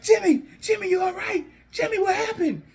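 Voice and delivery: high-pitched, alarmed brother; concerned. Acted. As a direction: act out panicked concern, very high. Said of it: "Jay's all concerned".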